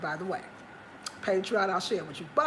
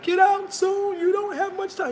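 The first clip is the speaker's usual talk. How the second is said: higher, louder mock alarm; mocking alarmist YouTubers; 9 semitones higher, 5 dB louder than usual. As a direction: parody a fear-mongering YouTuber warning you. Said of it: "even YouTubers that be like".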